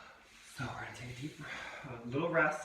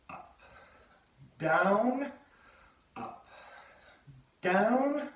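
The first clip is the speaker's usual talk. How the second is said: louder, higher count caller on down; high school gym voice; 5 semitones higher, 5 dB louder, much slower than usual. Retold or imitated. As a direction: call it like a gym class tape. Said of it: "try to do the voice like we used to listen to in high school".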